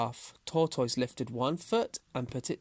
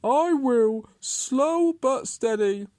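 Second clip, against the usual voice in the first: slow, loud, raised tortoise voice; calm tortoise; 11 semitones higher, 7 dB louder, slower than usual. A narrator's reading. Tortoise assures the mocking Hare, calm, slow and loud. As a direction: draw each word out with steady resolve. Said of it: "Tortoise said calmly".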